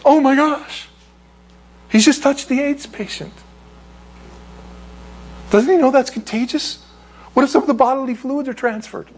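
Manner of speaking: high, fussing mock panic; horrified bystanders. Bystanders aghast that Jesus touched the leper, high mock panic. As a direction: fret like a scandalised onlooker. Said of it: "just like everybody else".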